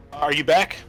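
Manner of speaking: normal talking voice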